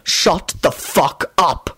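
slow, word-by-word angry bark. A narrator's reading. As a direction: bark each word slowly. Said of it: "Quentin barked out".

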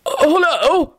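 high, polite hello; polite greeting. A narrator's reading. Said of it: "Richard said to her politely".

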